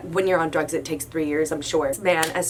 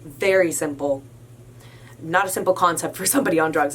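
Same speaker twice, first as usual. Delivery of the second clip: normal talking voice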